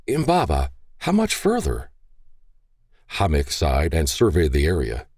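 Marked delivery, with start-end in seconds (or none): 0.1-1.9 put-on young boy's quiet question; a boy asking his father softly; quietly asking boy. A narrator's reading. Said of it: "quietly asked".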